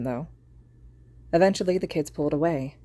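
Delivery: plain reading voice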